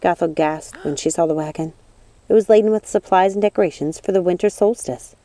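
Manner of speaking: ordinary delivery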